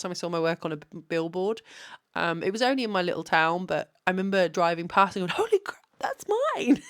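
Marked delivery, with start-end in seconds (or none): 5.4-6.8 very high, thrilled exclamation; excited squeal. Retold or imitated. Driving past her billboard, squealing with excited disbelief. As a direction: squeal it like you just spotted your own work.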